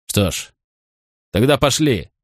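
mild narrated bark; curt, understated snap; barked order. A narrator's reading. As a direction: order the boy along gruffly, without shouting. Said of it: "рявкнул Ланс своему мальчику".

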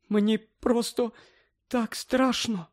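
high, soft, very slow and tearful; frightened confession. Acted. Mother confessing her fear to Harry, high and soft. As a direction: confess your fear through tears. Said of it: "зізналася вона".

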